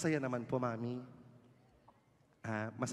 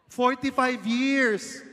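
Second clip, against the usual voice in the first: high, slow loud call across the room; host calling out to a couple in the crowd; 8 semitones higher, 7 dB louder, much slower than usual. Real, not acted. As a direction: shout the question to the back rows. Shouting number.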